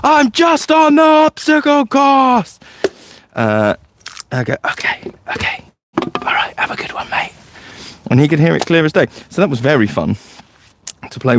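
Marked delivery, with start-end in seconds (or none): 0.0-2.6 high-pitched, drawn-out calling back; imitated distant shout. Boy shouting back from across the woods, high drawn-out yell. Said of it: "I would hear him shout back". 4.6-7.3 very soft, voiceless reply; retold whisper. Leader answering a distant boy through his hearing device, whispered. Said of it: "I would whisper things and go".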